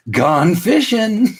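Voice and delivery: high, drawn-out "gone fishing" sing-out; playful sign-off. Pretend. Host signing off from social media, playful sing-out. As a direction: sing it out like a cheery shop sign.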